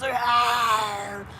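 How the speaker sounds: a short mock growl of a big dinosaur; dinosaur roar. Mom retelling the dinosaur roaring at her little son, mock roar. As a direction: roar like the mechanical dinosaur looming over a toddler. Mechanical dinosaur roars at boy.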